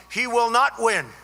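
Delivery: loud, emphatic imitation of an angry denial; hammed-up angry insistence, punching not; mock angry Obama. Rally speaker imitating President Obama's angry prediction, loud mock shout. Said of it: "The anger was unbelievable".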